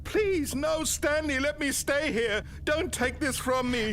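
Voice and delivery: begging narrator. Acted. Narrator's voice begs Stanley.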